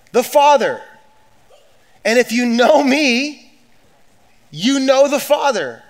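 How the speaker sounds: high, loud and slow; preaching at full force. Acted. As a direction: pull the crowd up with each phrase. Impassioned quoting at climax.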